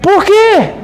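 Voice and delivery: high, pushy mock question; a hammed-up high-pitched "why?"; nosy surprise. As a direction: play the clueless friend pressing for why. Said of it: "Aí eu virei e falei".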